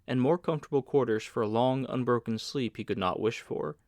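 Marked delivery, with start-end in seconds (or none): none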